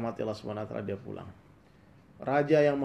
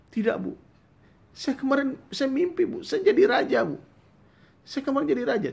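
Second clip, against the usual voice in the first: much higher, louder pleading insistence; insistent shout; 8 dB louder than usual. Acted. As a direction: insist desperately that you were king. Son insists he was king.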